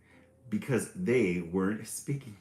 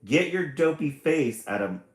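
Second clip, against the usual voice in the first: a loud, higher mock snarl; 6 semitones higher, 8 dB louder than usual. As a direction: bark it at her like a grumpy brother. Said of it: "snarled brother".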